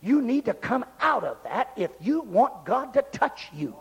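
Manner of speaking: ordinary delivery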